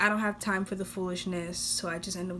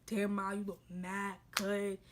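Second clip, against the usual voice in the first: catcaller impression; 7 dB quieter than usual. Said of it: "you spitting all this game".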